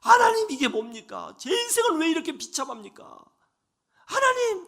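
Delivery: desperate anguished cry; angry prayer. Retold or imitated. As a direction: hurl the complaint at heaven. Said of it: "원망의 기도를 쏟아냈습니다".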